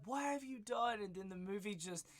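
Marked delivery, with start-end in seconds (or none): 0.0-1.0 high, raised anguished cry; mom screaming. Retold or imitated. Mom finding her dead son, imitating a scream. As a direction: cry out at the babysitter in shock. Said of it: "the mom's screaming".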